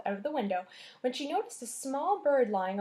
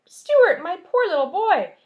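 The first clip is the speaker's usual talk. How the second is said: high, loud anxious mother; worried mother crying out; 8 semitones higher, 12 dB louder than usual. Acted. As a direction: cry out in pity for the shivering boy. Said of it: "she cried".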